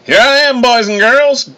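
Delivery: loud, high hollered southern drawl; fake southern accent. A narrator's reading. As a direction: yell it in a hammed-up southern accent. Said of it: "in the fakest southern accent".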